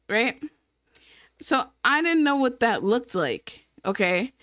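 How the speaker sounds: normal talking voice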